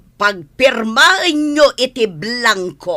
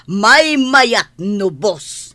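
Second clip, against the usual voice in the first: slow correction; much slower than usual. Acted. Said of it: "boss corrects how to address him".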